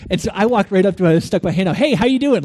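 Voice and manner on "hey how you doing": higher, upbeat retold hello; bright-pitched, forward "hey there" greeting; eager greeting. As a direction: hail a stranger with pushy friendliness. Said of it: "Like this".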